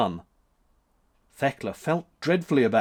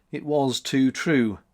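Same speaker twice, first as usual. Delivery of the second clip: plain reading voice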